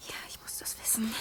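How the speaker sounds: quick urgent whisper. Acted. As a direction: whisper it, anxious. Quiet urgent aside during the ritual.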